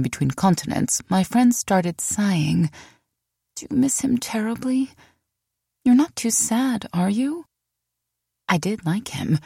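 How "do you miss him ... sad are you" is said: put-on high, sympathetic girlfriend coo; fussing friend. Friend fussing over her missing Trevor, high sympathetic coo. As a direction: ask it like a concerned best friend.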